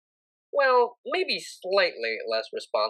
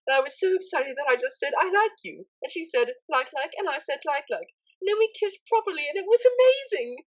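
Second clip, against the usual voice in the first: much higher, gushing and quick; breathless excited rush; 8 semitones higher than usual. A narrator's reading. Octavia tells her tutor about kissing Vinyl, gushing giddily. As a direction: pour it all out in one giddy breath. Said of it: "tell the story as fast as it crossed her mind".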